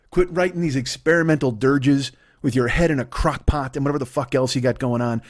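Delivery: ordinary talk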